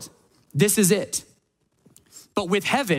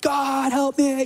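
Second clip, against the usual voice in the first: high, hammed-up cry for help; a mock scream for help, pitched up; mock panicked plea; 8 semitones higher, slower than usual. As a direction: play the panicked kid begging God. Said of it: "screaming like".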